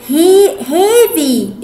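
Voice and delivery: "heavy" with a long ee, like "hee-vy"; wrong pronunciation. Shown as a demonstration. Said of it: "غلط".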